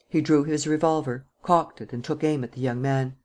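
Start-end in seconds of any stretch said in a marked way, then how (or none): none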